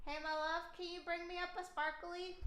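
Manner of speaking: soft, high-pitched request to a loved one; sweetly calling partner. Real, not acted. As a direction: ask your love sweetly, away from the mic. Calls to partner off mic.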